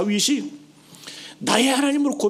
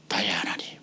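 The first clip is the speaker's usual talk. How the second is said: very low, soft whisper; hushed prayer; 13 semitones lower, 7 dB quieter than usual. Shown as a demonstration. Believer begins praying to God, hushed and low. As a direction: breathe it as a private prayer.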